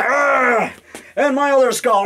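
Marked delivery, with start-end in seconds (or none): 0.0-0.7 monster growl. Acted. Skull toy attacking viewer.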